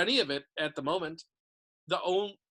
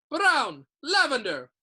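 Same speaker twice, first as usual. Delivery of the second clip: calling out names; 8 semitones higher, 9 dB louder, slower than usual. A narrator's reading.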